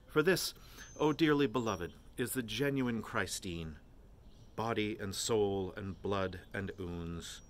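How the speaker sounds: mock-solemn sermon, slow and drawn out; preacher's tone. A narrator's reading. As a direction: intone it like a priest at the altar. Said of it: "in a preacher's tone".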